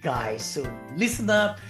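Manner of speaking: usual speaking voice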